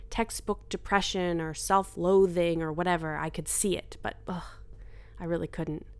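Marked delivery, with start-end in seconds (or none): none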